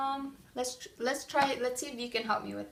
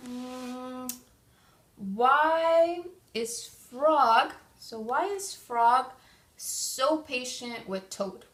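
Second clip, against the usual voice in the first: thinking aloud; 4 dB louder, much slower than usual. Shown as a demonstration. Teacher models thinking aloud.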